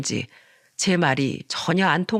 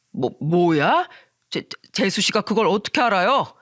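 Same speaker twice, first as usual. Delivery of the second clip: stammering, taken-aback man; flustered surprise. Acted. Friend asking how the wife knows, stammering surprise. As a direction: trip over the first words in alarm.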